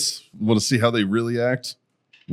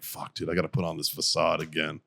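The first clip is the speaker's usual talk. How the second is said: low, soft weary self-talk; imagined exhausted sigh-talk; 5 semitones lower, 9 dB quieter than usual. Retold or imitated. Person readying the facade again, soft mock weariness. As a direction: say it low and quiet, worn out by pretending. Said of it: "I bet you that is exhausting".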